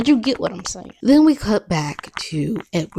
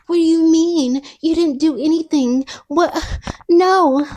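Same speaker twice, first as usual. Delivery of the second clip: squeaky high mock outrage, much higher and louder; mock high Bella; 10 semitones higher, 5 dB louder than usual. Recapping Twilight, mocking Bella's protest in a high loud voice. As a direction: ham it up as a shrill girlfriend. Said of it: "Okay, she doesn't talk like that".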